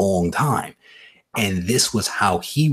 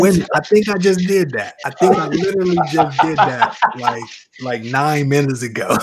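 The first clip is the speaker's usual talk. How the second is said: high, loud, breaking into laughter; tickled realization, pitched up; amused confession; 7 semitones higher, 4 dB louder than usual. Real, not acted. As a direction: own up mid-laugh. Joking admission, ends in laughter.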